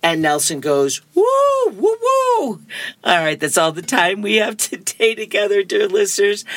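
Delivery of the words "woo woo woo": very high celebratory woo-woo; whooping cheer. Acted. Nelson celebrating his bargain, high-pitched whooping. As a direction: whoop high like a delighted kid.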